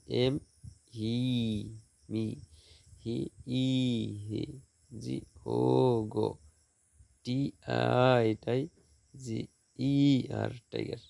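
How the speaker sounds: spell, then say "হি", "গো" with a long open vowel. Shown as a demonstration. Teacher showing open syllables, long vowels in "হি", "গো", "টাই". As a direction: draw out the vowels in "হি", "ই", "ও". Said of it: "ধ্বনি দীর্ঘ হয়".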